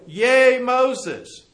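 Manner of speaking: a loud little cheer for Moses; mock cheer. Israelites cheering Moses as he promises to lead them out, loud mock cheer. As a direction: cheer it like a happy crowd.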